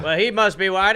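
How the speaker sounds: high, loud comic character voice; fooled guard. Acted. Guard reasoning about the disguised captain, hammed-up loud voice. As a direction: play a loud, gullible lookout.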